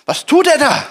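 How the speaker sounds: high, loud astonished question; shocked disciples. Retold or imitated. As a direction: gasp at what he is doing. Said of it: "mussten die Jünger denken".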